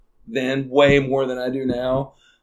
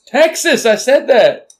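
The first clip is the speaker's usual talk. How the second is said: higher, louder triumphant blurt; excited shout; 10 semitones higher, 8 dB louder than usual. Real, not acted. Guessing the state in a quiz, shouting it out eagerly. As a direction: call out the answer, sure you've got it.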